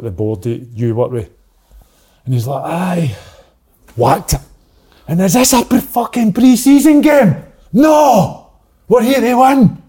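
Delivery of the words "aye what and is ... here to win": furious manager yelling at players; angry shout. Retold or imitated. Manager shouting at team, retold.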